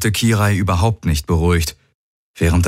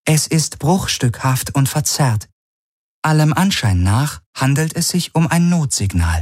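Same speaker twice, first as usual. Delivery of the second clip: higher-pitched computer report; measured synthetic announcer, pitched up; artificial ship voice; 8 semitones higher than usual. A narrator's reading. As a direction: read it out as the ship's AI. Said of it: "die künstliche Stimme der Vago".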